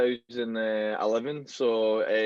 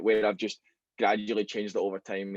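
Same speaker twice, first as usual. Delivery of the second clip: ordinary delivery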